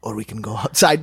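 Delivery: low menacing tough guy. Retold or imitated. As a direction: drop low, calm threat. Big Scot threatens fight.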